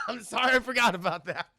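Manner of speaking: high mock apology through laughter; laughing apology. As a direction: crack up while acting out an apology. Teller cracks up acting the apology.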